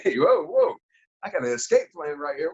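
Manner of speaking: high, eager call as the chance appears; excited. Retold or imitated. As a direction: jump at the opening. Boss absent, escape chance.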